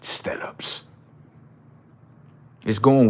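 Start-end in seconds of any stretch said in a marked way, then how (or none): 0.0-0.8 whispering. Real, not acted. Aside whispered.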